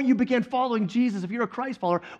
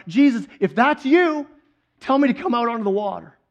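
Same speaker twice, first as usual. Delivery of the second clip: higher, louder mock call across water; retold yell; 3 semitones higher, 5 dB louder than usual. Peter calls to Jesus from the boat, retold yell. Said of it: "So Peter yells out".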